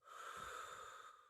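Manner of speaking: long unvoiced breath out; deep calming exhale. Shown as a demonstration. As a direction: breathe out slowly and fully. Calming breath after child's advice.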